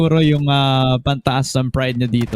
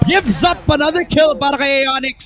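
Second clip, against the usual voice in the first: esports caster; 7 semitones higher than usual. Acted. Live play-by-play.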